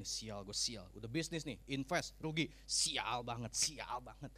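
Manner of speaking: low, disgruntled muttered complaint; cursing bad luck. Preacher mimics someone broke after a failed investment, cursing bad luck. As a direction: grumble at your own rotten luck. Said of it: "Ngomongnya jelek".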